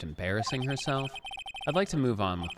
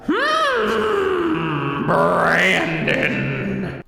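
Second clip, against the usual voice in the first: shouting high and loud; 7 semitones higher, 11 dB louder, much slower than usual. Real, not acted. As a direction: yell the name across the room. Yelling a name.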